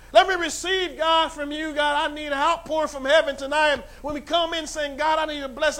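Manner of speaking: high, loud preacher's cry; full-force preaching. Acted. Pastor crying out to God before the church, loud preaching. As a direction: lift the church with each line.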